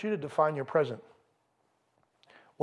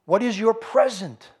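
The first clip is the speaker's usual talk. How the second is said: pushed up and louder, higher; louder, pressing question; 4 semitones higher, 5 dB louder than usual. Acted. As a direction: hit the question hard at the crowd. Preacher repeats his key question.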